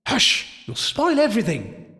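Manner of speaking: put-on high-pitched girlish shushing; girls hushing. A narrator's reading. Girls to the child spoiling the game, hushing. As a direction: shush a friend in a girl's voice. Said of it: "some girls said".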